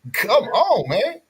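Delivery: ordinary delivery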